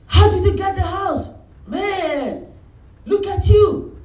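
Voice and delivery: high, loud admiring exclaim; impressed friend marveling; mock wonder; amazed friends. Friends marvel at his house.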